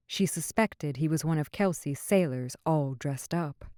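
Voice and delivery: usual speaking voice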